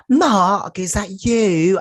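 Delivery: put-on high, loud, drawn-out British accent; mock British accent. Layla finding Mark, exaggerated high British accent. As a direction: put on a hammed-up British woman's voice. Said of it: "she doesn't have a British accent".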